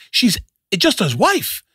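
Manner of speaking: high-pitched imitation of an exasperated friend; exasperated scolding. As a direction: scold him in disbelief, acted out. Retells Chelsea's exasperated scolding.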